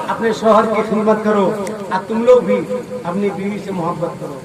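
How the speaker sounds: usual speaking voice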